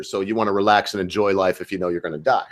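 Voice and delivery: usual speaking voice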